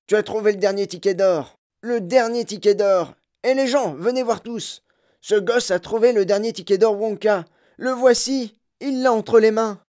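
loud, high, near-hysterical shouting; frenzied yelling. A narrator's reading. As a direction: holler to summon everyone. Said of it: "le marchand allait avoir une crise".

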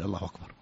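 الله أكبر run together as "Allahu wakbar"; no glottal stop in أكبر, a w glide after الله; waw in place of hamza. Shown as a demonstration. Scholar naming what the educated avoid, hamza softened to waw. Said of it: "فلا، فلا يقول".

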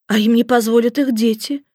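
ordinary delivery

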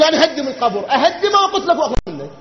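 loud, laughing imitation of the threat; mock their threat while laughing. Mocking the opponents' threat. (تقليد ساخر للتهديد مع ضحك)

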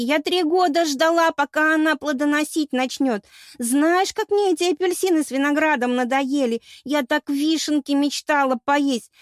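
high, loud tearful complaint; sobbing lament. A narrator's reading. Goddess lamenting cherries she waited years for, sobbing wail. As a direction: keep wailing through the whole grievance. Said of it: "Всхлипывала богиня".

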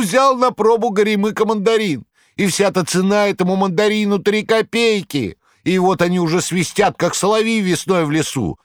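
milk the crowd's pity; Korovyev's tirade. A narrator's reading. Korovyev haranguing the shop crowd, high whining plea.

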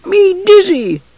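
very high, squealing little creature; dizzy high-pitched dwarf wail; shouting gully dwarf. A narrator's reading. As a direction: cry out in a tiny high voice. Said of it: "the gully dwarf shouted suddenly".

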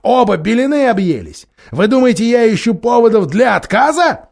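loud, high furious questions; outraged king. A narrator's reading. Miraz accusing his lords of madness, loud furious voice. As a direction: rage at both lords.

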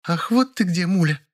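high, put-out female voice; displeased woman. A narrator's reading. Zina finds Mulya at work, displeased. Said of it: "раздался недовольный голос".

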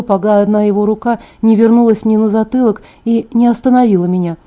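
ordinary delivery

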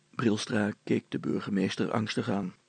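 ordinary delivery

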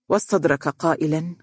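plain reading voice

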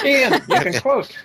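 sudden high, sing-song handoff to Ian; mock grand handoff. Host passing the turn to Ian, sing-song high. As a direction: hand it over in a bright, mock-formal lilt.

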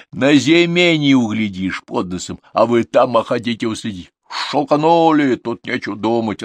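loud, high, slow pronouncement; emphatic peasant certainty; categorical. A narrator's reading. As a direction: shut the argument down. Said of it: "отрубил он".

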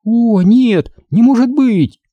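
high-pitched disbelieving exclamation; alarmed cry. Acted. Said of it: "и она закричала". (испуганный высокий вскрик)

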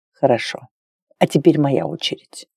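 low, softened man's reply; lightly voiced male lover. A narrator's reading. Lover tells Claire it is his turn, low male voice. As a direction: keep it low and quiet.

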